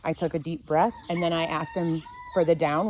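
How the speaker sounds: ordinary talk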